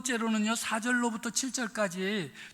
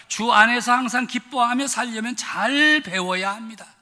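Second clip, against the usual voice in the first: rising preacher's emphasis; 3 semitones higher, 7 dB louder than usual. Acted. Refrain building to a climax.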